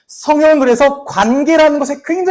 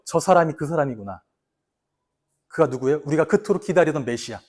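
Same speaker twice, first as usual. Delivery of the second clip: soft, hushed, lower voice of Peter realizing; awed inner realization; 8 semitones lower, 9 dB quieter than usual. Retold or imitated. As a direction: murmur it as if to himself, amazed. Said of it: "단번에 아는 거죠". (깨달은 베드로의 조용하고 낮은 속삭임)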